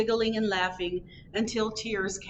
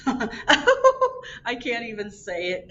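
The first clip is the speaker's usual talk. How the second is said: slow, louder words broken by giggles; giggling; 3 semitones higher, 6 dB louder, slower than usual. A narrator's reading. Rhinoceros giggling, unable to say his own name. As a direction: giggle too much to say it. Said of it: "giggled the rhinoceros".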